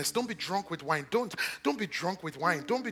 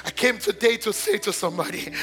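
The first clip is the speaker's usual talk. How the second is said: ordinary talk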